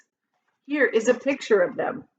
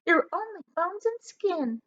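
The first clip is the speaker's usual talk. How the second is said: preen like a conceited hag; shrill-voiced boastful aunt; Aunt Sponge's voice; 10 semitones higher than usual. A narrator's reading. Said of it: "Aunt Sponge cried out".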